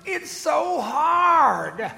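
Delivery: high, loud, slow complaining plea; whiny complaint. Pretend. Congregation member whining to the preacher, slow and loud. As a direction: whine it like a worn-out listener. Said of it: "you might be saying".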